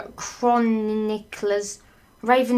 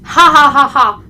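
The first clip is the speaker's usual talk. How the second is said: loud, high, spelt-out ha-ha; hammed-up gloating laugh; villain laugh; 4 semitones higher, 11 dB louder than usual. Villain gloating that he won't be believed, mock laugh.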